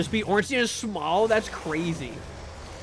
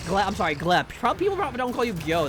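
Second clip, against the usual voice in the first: ordinary delivery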